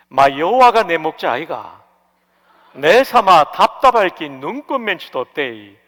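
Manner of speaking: psalm read lightly in a Gyeongsang accent; 경상도 사투리로 가볍게 읽기; Gyeongsang dialect reading. Shown as a demonstration. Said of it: "경상도 말이 익숙하지 않습니다".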